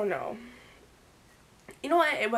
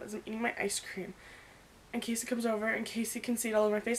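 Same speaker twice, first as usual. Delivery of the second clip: ordinary talk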